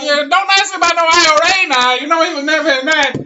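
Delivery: high, loud hectoring put-on voice; Mary Lou McDonald. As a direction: squawk it as an indignant politician. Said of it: "Mary Lou McDonald impression".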